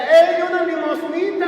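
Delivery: high, loud, drawn-out begging call; acted cry of a street beggar; beggar's shout. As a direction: call out slowly for alms. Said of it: "les gritaba y les decía".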